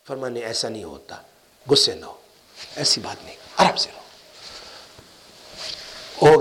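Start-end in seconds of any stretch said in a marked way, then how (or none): 0.3-4.0 calm reassurance. Retold or imitated. Elder calming an angry disciple.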